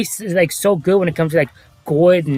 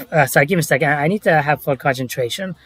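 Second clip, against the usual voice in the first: ordinary delivery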